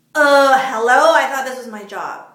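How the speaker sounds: can you believe this, she pushes back at her boss; indignant hello. Retold or imitated. Unpaid friend answers her boss.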